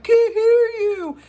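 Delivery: high, loud mock yell from the back of the room; heckler yell. Vlogger imitates a heckler at a talk, loud and high-pitched mock yell. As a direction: bellow it like a heckler in the back row. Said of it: "some asshole in the back that's like".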